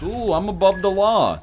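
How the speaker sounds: high, put-on boastful voice; teasing high imitation of an entitled viewer; mock subscriber. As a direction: pitch it up and mock smugness. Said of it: "Here's my impression of subscribers".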